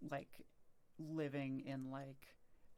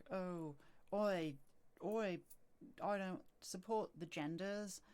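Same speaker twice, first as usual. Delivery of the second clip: higher, hammed-up bad British accent; 4 semitones higher, slower than usual. Random transphobe on the street, in a bad British accent. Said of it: "it's like a bad British accent".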